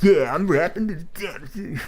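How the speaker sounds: high, slow, smug mock rap; smug rapper voice. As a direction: sneer it like a show-off. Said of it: "that sort of, like, douchiness".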